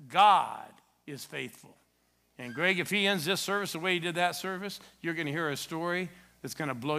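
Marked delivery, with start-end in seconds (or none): 0.1-1.7 "God is faithful" very slow and loud; slow emphasis. Real, not acted. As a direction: drive home "God is faithful" word by word. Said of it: "This is it".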